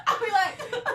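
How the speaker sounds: high-pitched laughing words. Real, not acted. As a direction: laugh right through it. Laughing at the idea.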